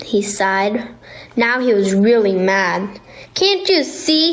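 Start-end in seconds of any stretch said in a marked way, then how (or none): 3.3-4.3 put-on high, angry child-like shout. A narrator's reading. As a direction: yell it up at the crowd in a rage. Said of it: "he shouted".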